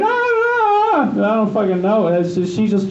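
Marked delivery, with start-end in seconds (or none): 0.0-1.1 very high, mocking "ah, ah"; mock dismissive ah. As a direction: brush him off with squeaky "ah"s. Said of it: "She's like".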